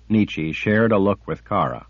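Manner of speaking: ordinary delivery, nothing marked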